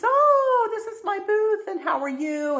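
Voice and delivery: imitating an overly friendly seller; sugary booth owner. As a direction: gush a sweet hello as the booth owner. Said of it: "She says".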